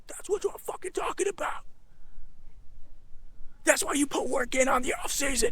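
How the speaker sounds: high, strained half-whispered yell; muted mock scream. Teen hyping himself up in the car, muted mock scream. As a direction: imitate screaming without full volume. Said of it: "I remember screaming".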